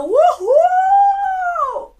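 very high, loud, long re-enacted whoop; whooping cheer. Son cheering despite mom's shushing, whooping cheer. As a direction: whoop it out defiantly and hold it.

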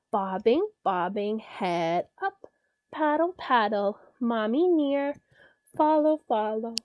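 bouncy, playful sing-song reading; reading to a toddler. A narrator's reading. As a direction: pop each little word for a toddler. Reads duckling poem to child.

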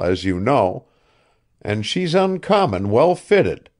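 put-on an old man coaxing, talking up his pet ship; old ship owner Asa. A narrator's reading.

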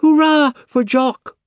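put-on little girl shouting a joyful welcome. A narrator's reading. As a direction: cheer it out with delight.

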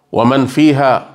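normal talking voice

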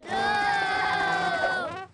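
coughing fit. Acted. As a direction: cough hard and high. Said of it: "Sorry if my voice changes".

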